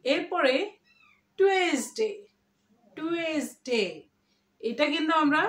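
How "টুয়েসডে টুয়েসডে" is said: TUESDAY as drawn-out "tu-ES-day"; usual pronunciation. Shown as a demonstration. Teacher showing how we commonly say it, usual three-syllable pronunciation. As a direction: say টুয়েসডে with a separate "tu-es". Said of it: "এইভাবে উচ্চারণ করি".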